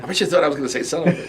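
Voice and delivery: quick Porky Pig voice. Retold or imitated. Porky Pig gag line.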